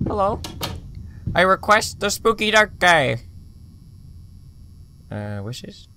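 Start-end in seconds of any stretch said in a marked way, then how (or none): 0.1-3.2 summoning call. Real, not acted. Calling out to summon spirit.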